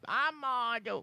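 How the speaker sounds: soft pained groan about the back. Acted. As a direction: wince and groan quietly. Character groans about back again.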